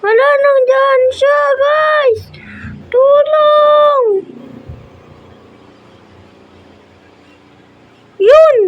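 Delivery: very high, loud frightened cries; panic, then a cry of relief; screaming in panic. Real, not acted. Chased, then escapes.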